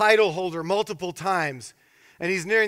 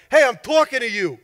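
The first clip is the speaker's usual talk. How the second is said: loud, higher Chicago Italian gangster voice; Italian gangster accent; 5 semitones higher, 6 dB louder than usual. Retold or imitated. Thug confronting the silent pastor, Italian gangster accent. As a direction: demand attention like an Italian-American gangster. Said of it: "accent of an Italian gangster in Chicago".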